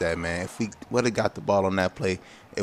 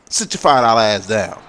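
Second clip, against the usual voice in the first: loud taunting trash talk; trash talk shout; 2 semitones higher, 8 dB louder than usual. Real, not acted. Taunting the defender he flattened, loud trash talk.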